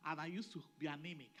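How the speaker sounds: ordinary talk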